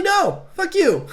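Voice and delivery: high, loud, drawn-out mock outrage; mock indignant refusal. Retelling Ripley turning down the company man, mock indignant.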